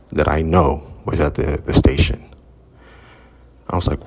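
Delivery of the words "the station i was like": ordinary delivery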